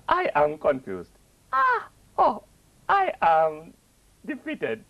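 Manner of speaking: put-on high, slow West African accent, growing confused and defeated. Imitating a West African asking Karan for help, high West African accent. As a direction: play a bewildered West African asking for a television. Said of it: "West Africans, who go off on another trip".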